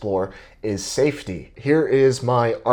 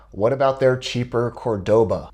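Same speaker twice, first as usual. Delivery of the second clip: ordinary talk, nothing marked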